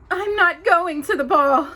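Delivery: high, loud, tearful Cinderella; tearful refusal. Acted. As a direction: refuse through the tears.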